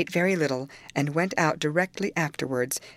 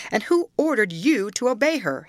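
higher, louder demanding question; sharp demand; 7 semitones higher, 5 dB louder than usual. A narrator's reading. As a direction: demand an answer, voice raised and lifted. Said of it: "he added, turning to Hareton".

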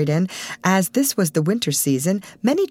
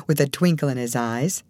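ordinary delivery, nothing marked

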